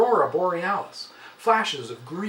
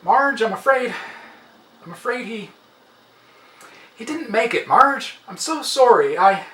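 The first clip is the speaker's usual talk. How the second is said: halting, louder delivery of bad news; grieving coworker; 4 semitones higher, 5 dB louder than usual. A narrator's reading. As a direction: stumble through telling her he died. Coworker announces death.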